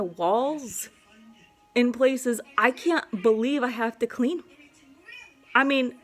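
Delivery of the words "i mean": high exasperated rant. Real, not acted. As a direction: pitch shoots up, loud and exasperated. Ranting about pee on walls.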